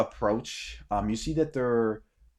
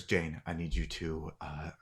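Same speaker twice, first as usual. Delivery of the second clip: quiet instruction; 4 semitones lower, 7 dB quieter than usual. Acted. Man quietly instructs the woman.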